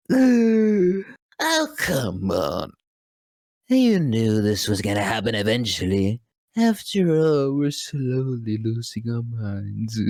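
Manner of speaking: wild manic Joker with cackles. Acted. Voice actor auditioning for Joker, manic cackling Joker. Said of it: "I just go off".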